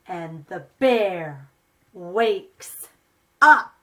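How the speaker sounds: put-on lively read-aloud for small children; story reading. A narrator's reading. Reads picture book to children.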